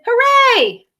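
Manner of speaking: high, loud hooray; cheering shout. Acted. The Alphabet cheers Bradley and Ivy, joyful. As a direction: cheer the returning hero.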